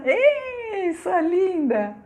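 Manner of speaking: high, sing-out hello, drawn out; light high squeal for a friend just spotted; excited greeting. Real, not acted. Breaks off to greet a viewer. (saudação animada, aguda e esticada)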